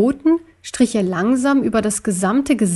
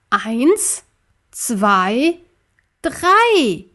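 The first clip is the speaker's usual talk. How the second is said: counting to a joint effort; much slower than usual. Acted. Counting down to push together.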